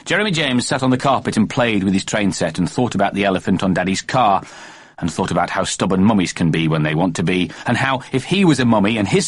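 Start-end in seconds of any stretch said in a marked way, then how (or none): none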